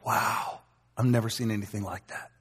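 soft awed whisper, wow unvoiced; awed hush. Leaving church, whispering in awe. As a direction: breathe it out in wonder. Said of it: "they go".

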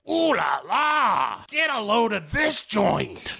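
high, slow hammed-up leering detective; Michael Douglas impression. Detective arriving at beach house, mock-impressed high voice. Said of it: "Michael Douglas is like".

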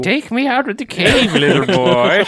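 high, lightly mocked creature plea. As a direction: beg like the odd cave creature. Mocking creature quote.

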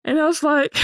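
high-pitched laughter breaking through; laughing high. Real, not acted. Laughing panic retelling.

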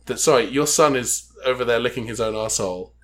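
ordinary delivery

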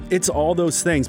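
usual speaking voice

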